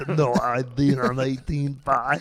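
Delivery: slow, drawn-out put-on member voice; silly caricature voice. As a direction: drag out the words as a fussy caricature. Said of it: "Why do I talk like this".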